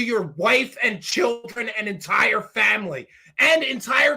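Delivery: indignant rant, punching key words loud and high; loud, drawn-out words of outrage; angry scolding. Real, not acted. Host berating Zabel about his family, angry scolding.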